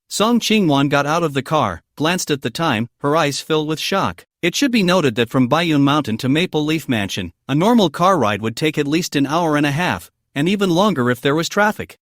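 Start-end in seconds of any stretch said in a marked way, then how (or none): none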